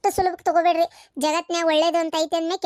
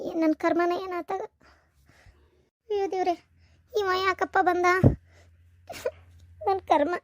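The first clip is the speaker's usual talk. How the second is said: lamenting; 2 semitones higher, 4 dB quieter than usual. Acted. Wife laments her fate alone.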